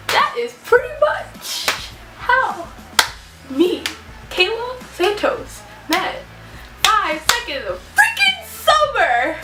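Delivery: slow, high dramatic announcer. Shown as a demonstration. Vlogger announcing how she met the band, slow and grand. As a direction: draw out the big reveal. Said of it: "dramatic outro announcement".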